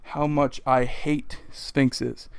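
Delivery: slow, heavy emphasis on how much I hate Sphinxes; slow deliberate stress; emphatic. Real, not acted. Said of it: "I cannot emphasize enough".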